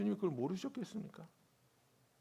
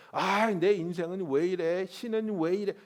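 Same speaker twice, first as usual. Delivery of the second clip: whiny complaint; 3 semitones higher, 9 dB louder than usual. Retold or imitated. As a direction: moan about your life to a neighbor. Complaining to the person beside you.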